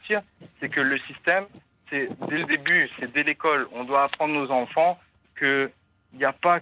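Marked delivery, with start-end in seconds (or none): none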